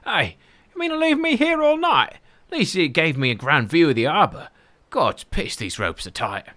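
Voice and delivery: hanging from a rope and complaining; Jack's odd voice. Acted. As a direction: Jack strung up, squeaky and indignant. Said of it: "I don't know why he has this voice".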